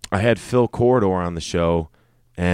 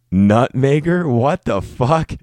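higher, louder, slowed disbelief; incredulous disbelief; 7 semitones higher, 6 dB louder than usual. Real, not acted. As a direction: stretch it out, can't believe the word. Incredulous at odd name.

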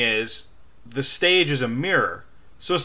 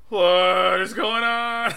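confused; 6 semitones higher, 4 dB louder, much slower than usual. Retold or imitated. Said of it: "And it was like".

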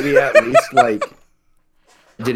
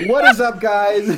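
loud, higher mock vlogger greeting; YouTube vlogger; 7 semitones higher, 4 dB louder than usual. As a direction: hype up the camera. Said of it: "Just vlogging".

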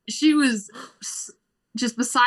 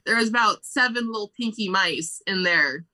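normal talking voice

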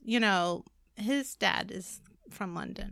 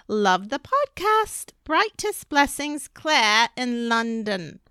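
slow, louder British sign-off; British accent; 7 dB louder, slower than usual. Pretend. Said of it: "my own impression".

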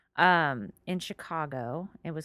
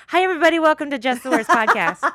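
announcer voice; 9 semitones higher, 12 dB louder than usual. Acted. Podcast intro greeting.

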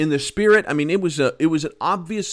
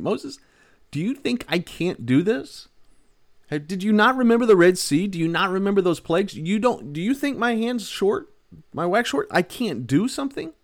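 incredulous God; 7 semitones higher than usual. Acted. God chiding Moses for doubting, high incredulous voice. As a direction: play God, amazed anyone doubts him.

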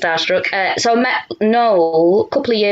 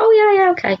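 imitating Noel; much faster than usual. Noel agreeing to meet a fan, an imitated breezy okay. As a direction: toss it off like a star being polite.

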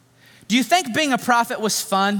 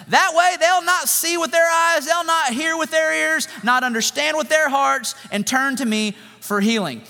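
sarcastic God; 9 semitones higher than usual. Acted. God sarcastically instructs Isaiah, high mocking sing-song. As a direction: sneer each command at a prophet you know will fail. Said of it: "Can you hear the sarcasm?".

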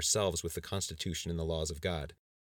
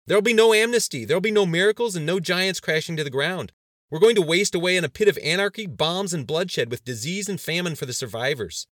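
high, frantic shouted tirade; loud, agitated outburst; raised voice; 11 semitones higher, 12 dB louder than usual. A narrator's reading. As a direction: shout them down with doom. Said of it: "raising his voice over the general's".

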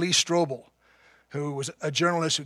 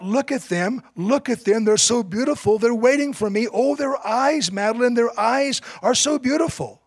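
higher, louder, rapturous calling out; crying out in awe; 7 semitones higher, 5 dB louder than usual. Retold or imitated. Dying husband tells his wife of the angels, raised and rapt. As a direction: voice a dying man who sees something beautiful. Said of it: "He cried out".

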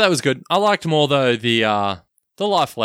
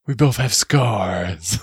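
very slow, stretched film-star imitation; slow, badly faked movie cowboy; Clint Eastwood; much slower than usual. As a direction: drag out each word in a bad actor impression. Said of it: "your Clint Eastwood accent here".

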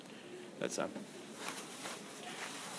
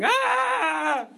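sudden high yelp of alarm, louder; panicked scream; 6 dB louder than usual. Acted. Disciples freaking out in the storm, a high panicked shriek. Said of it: "sort of freaking out and going".

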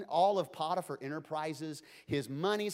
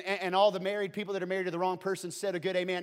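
ordinary talk

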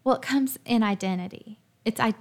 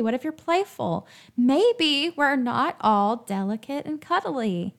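normal talking voice

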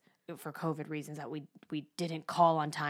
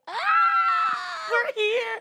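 very high, loud excited scream; overjoyed child's squeal; 7 dB louder, slower than usual. Retold or imitated. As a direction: squeal like a thrilled kid arriving. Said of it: "squeezed her hand in her face".

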